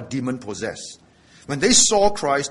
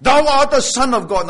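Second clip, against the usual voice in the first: "Thou art the Son of God" shouted out, higher and louder; shouted proclamation; 7 semitones higher, 6 dB louder than usual. Shown as a demonstration. Preacher shows how the demons cried it out, shouting. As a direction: cry it out like the possessed man proclaiming. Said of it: "and shout out loud".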